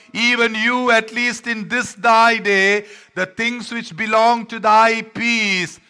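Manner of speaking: loud, high, slow lament as Jesus; Jesus calling out. Acted. Jesus calling out to Jerusalem, loud slow lament. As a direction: cry it out over the city. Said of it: "he is calling out".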